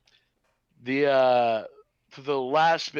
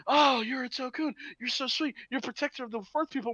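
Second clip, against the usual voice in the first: mock sweet character; 8 semitones higher than usual. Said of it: "'cause it's like".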